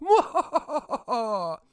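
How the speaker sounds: loud, high hammed-up evil cackle; mock evil laugh. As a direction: cackle like a villain.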